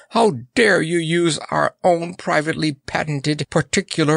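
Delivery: high-pitched indignant tirade; outraged scolding. A narrator's reading. Monarch accuses the clown of stealing the rhyme, high and indignant. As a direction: scold in high, affronted fury.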